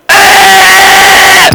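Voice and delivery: high, loud mock yelp of pain; mock scream. CB trucker mimics rival's yelp, hammed-up scream. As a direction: yelp it like a man choked by a chain. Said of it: "you're going".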